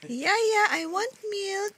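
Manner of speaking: put-on high, drawn-out baby-style call; speaking for baby. Parent voicing baby calling the nanny, whiny baby-talk. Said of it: "Sabihin mo kay Yaya".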